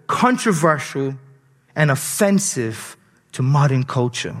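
slow, deliberate restatement. Shown as a demonstration. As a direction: spell it out slowly for them. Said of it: "I'll slow down a bit for you".

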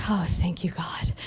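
breathy whispered relief, acted out; relieved whisper. Woman recalling her relief at a yes, relieved whisper. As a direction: whisper it like a relieved sigh of thanks. Relieved aside in retold story.

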